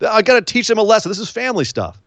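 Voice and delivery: quick, high brush-off; dismissive. Retold or imitated. Roman waving off the referee, fast and high. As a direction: rattle it off like it's obvious. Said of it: "And Roman's like".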